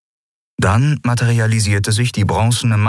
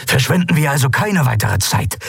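urging; 7 semitones higher than usual. Acted. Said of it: "drängte Andalok".

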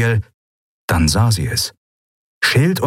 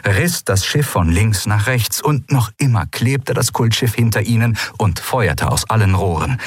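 ordinary delivery

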